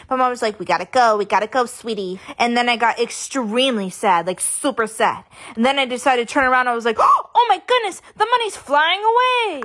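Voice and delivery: big, loud vlog-style story narration; loud storytelling. Acted. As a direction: tell the tale to the camera with full energy.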